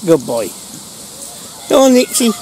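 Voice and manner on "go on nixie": higher and louder, rising on ready; excited fetch call. Real, not acted. Owner about to throw the ball, higher and louder. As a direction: wind the dog up before the throw. Sending the dog off.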